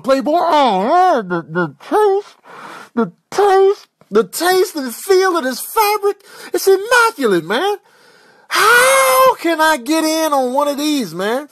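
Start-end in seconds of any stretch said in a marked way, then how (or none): 1.8-11.1 loud, high, slow relish; hammed-up connoisseur's ecstasy; rapturous raving. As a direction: rave about it, stretching the key words high. Animal raving about a shirt's fabric.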